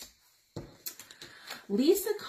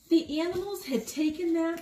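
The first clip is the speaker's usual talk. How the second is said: usual speaking voice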